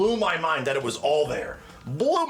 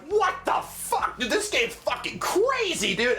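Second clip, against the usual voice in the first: high, slow, drawn-out disbelief; stunned amazement; 9 semitones higher, slower than usual. Retold or imitated. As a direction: stretch it out, blown away. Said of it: "I'm like".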